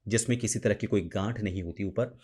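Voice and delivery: normal talking voice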